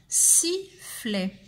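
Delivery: "Sifflet" said as one word; measured "si-fflè", no long gap; syllables joined up. Shown as a demonstration. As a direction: recap "Sifflet" in two close beats. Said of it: "on va écrire la deuxième syllabe".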